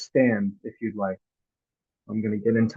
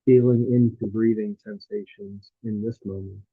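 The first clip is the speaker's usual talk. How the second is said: guided meditation; 2 semitones lower than usual. Real, not acted. Teacher guiding listeners through a body scan, calm meditative delivery.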